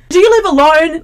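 high, loud, pointed question. Retold or imitated. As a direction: ask it loudly and high. Blonde girl asks.

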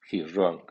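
"He's" only moderately stressed before "wrong"; medium stress on HE'S. Shown as a demonstration. Teacher models a pronoun statement, medium stress on "He's". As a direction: give "He's" a medium accent, falling on "wrong". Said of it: "el acento medio del pronombre".

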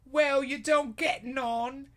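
high, slow Aussie teasing; hammy Australian actress; mock Australian accent. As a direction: tease in a high put-on Australian voice. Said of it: "And I'm Margot Robbie".

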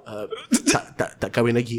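put-on quiet, shy kid answer; shy soft mumble. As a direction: mumble it awkwardly. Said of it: "I lepas perform kan".

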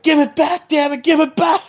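very high, frantic mock plea; hammed-up high-pitched panic; mock frantic outburst. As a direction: imitate someone desperate, voice shooting way up. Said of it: "it's a very high emotion exchange".

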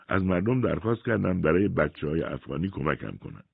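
normal talking voice

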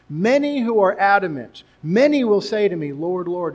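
loud, high fervent preaching; impassioned pulpit voice; preaching at full force. Acted. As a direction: drive the scripture home to the congregation. Preacher driving the text home.

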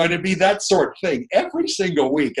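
ordinary talk, nothing marked

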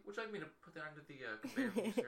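high, chirpy fake politeness; sugary cashier voice with a laugh; customer service voice. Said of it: "learn your fake voice".

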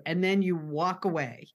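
ordinary talk

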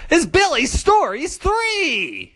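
high, slow showman's call; segment announcement. Pretend. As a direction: stretch the title out like an announcer. Host announces favourite segment.

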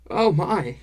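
high, loud cry of astonishment; startled, high-pitched exclamation; astonished cry. A narrator's reading. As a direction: cry out in shock as a dwarf woman. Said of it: "she cried".

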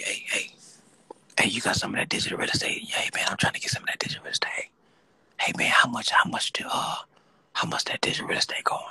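hurried, secretive whispered imitation; mock whisper. Host imitating caller pricing digital real estate, whispering. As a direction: whisper furtively like a buyer asking the price. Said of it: "Brother got the whispering on me".

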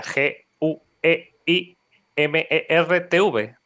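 spelling each letter slowly; deletreando. Shown as a demonstration. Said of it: "deletréalo". (deletreando despacio letra a letra)

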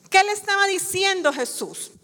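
ordinary delivery

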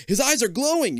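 high, loud panicked cry; frightened cry. Acted. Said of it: "you cry out".

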